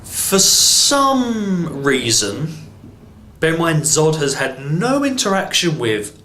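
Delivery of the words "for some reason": sarcastic drawl. Real, not acted. Sarcastic setup of plot hole.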